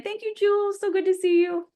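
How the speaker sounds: high, bright-eyed hello to a friend; delighted high-pitched welcome; excited greeting. Real, not acted. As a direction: lift way up to greet her.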